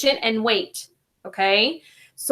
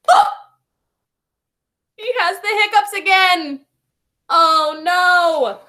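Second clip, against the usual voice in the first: dismayed exclamation; 6 semitones higher, 4 dB louder than usual. Acted. Dismayed about returning hiccups.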